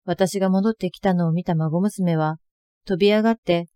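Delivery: normal talking voice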